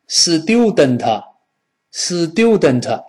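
student as "si-diu-den-te", every consonant given a vowel; slow, clumsy Chinese-style student; mispronounced student. Shown as a demonstration. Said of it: "这好难听啊". (中式错读 student，逐音节加元音)

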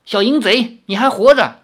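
high, loud mocking jab; scornful taunt. Girl mocking the young man, high and loud. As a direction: sneer at him.

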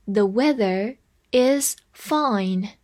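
slow, careful reading of the sentence. Shown as a demonstration. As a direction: spell out each word for learners. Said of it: "再来一遍". (慢读例句)

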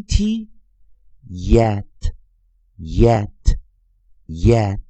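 very slow spelled-out yet, each yet stretched; spelling drill. Shown as a demonstration. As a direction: spell it, then chant yet three times for students. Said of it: "两个生词Y E".

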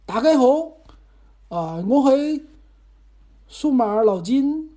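high, loud, halting Cantonese greeting. Shown as a demonstration. Host trying a new show opening, halting Cantonese. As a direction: piece together a Cantonese intro, slowing at the end. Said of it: "再整两句词儿哈".

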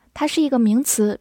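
normal talking voice

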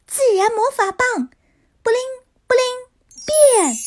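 high, ringing spell casting; tiny tinkling fairy voice. Acted. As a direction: cast the spell like a bright fairy. Fairy casts magic spell.

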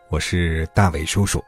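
ordinary delivery, nothing marked

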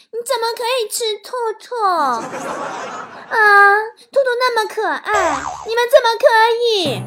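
high, loud, cutesy pleading; overly sweet, fake TV-drama whine. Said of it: "连说话都假到啊，就像读电视剧的台词似的".

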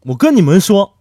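loud, eager lead-in; excited opener. Acted. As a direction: grab the crowd. Comic storyteller opening aside.

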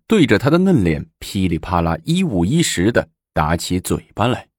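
ordinary delivery, nothing marked

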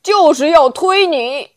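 loud, booming royal pronouncement; the king booms at old onion. Acted. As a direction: boom it out like a bell. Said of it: "声如洪钟地说".

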